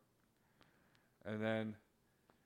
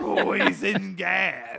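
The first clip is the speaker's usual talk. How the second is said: slow drawn-out shout; mock shout echo; 4 semitones higher, 4 dB louder, much slower than usual. Buddy echoes the joke, slow mock shout. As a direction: echo it back, big and slow.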